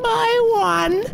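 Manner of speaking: high, slow croaky witch voice; croaking witch. Acted. Witch gives instructions.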